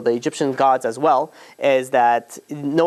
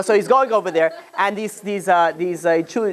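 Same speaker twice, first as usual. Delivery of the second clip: usual speaking voice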